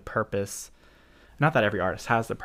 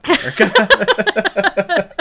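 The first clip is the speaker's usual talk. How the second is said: laughing while reading; 10 semitones higher, 11 dB louder, much slower than usual. Real, not acted. Giggles reading Lynch quote.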